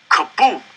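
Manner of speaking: loud mock explosion; explosion sound. A narrator's reading. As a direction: belt out the blast like a bomb. Narrator voices explosion sound.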